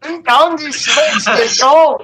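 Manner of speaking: put-on a joking accented race caller; mock announcer accent. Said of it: "mock race announcer voice".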